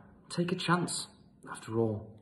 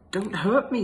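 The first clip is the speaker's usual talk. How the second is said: high, loud frightened plea; whimpering lion; 9 semitones higher, 8 dB louder than usual. Acted. Lion begging the mouse, high and scared. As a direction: beg in panic. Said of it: "he whimpered".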